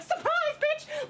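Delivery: high-pitched gleeful pounce; mock ambush cry. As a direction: pop out with a high squeal. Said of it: "pop out from the bushes behind you like".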